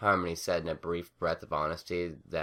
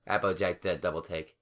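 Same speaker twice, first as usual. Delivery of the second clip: ordinary delivery, nothing marked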